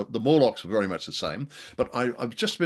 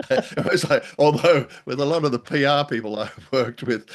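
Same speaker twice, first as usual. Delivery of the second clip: laughing; 5 semitones higher, 5 dB louder than usual. Real, not acted. Laughing through PR anecdote.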